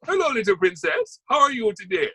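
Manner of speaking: put-on high, sing-song West Indian accent; a jolly West Indian gardener greeting a little girl. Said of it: "who talks a bit like that".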